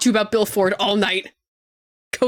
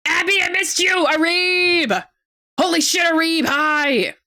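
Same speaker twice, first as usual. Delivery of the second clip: excited shouted greeting; 7 semitones higher, slower than usual. Real, not acted. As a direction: yell hi to your buddy. Friend arrives in chat.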